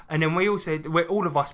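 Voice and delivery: ordinary delivery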